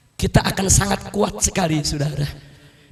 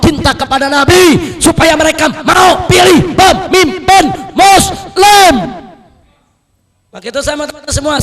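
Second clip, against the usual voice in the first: rising, loud exhortation peaking in high shouts; fiery preaching; 5 semitones higher, 10 dB louder than usual. Real, not acted. Preacher sends followers out to win hearts, fiery preaching. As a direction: build to a roaring rally cry. Preacher builds to rallying cry.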